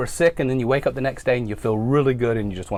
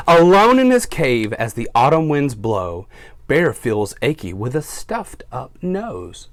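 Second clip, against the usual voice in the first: storybook read-aloud for little listeners; reading to children; 4 dB louder, slower than usual. A narrator's reading. Teacher reads to her class, storytime voice.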